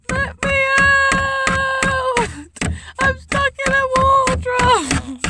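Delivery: trapped yell. Pretend. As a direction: yell to be let out. Trapped, louder second take.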